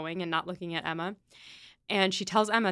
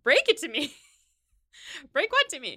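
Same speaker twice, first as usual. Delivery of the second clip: higher and louder, mock alarm; alarmed quote; 7 semitones higher, 4 dB louder than usual. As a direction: play Emma startled and demanding. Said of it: "And Emma's like".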